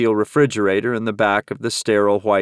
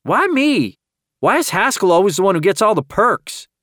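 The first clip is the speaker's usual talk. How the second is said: whiny protest; 10 semitones higher than usual. A narrator's reading. Deputy protesting to the sheriff about perks, high whiny voice. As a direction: squeal the complaint like a kid left out. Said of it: "Zeke instantly protested".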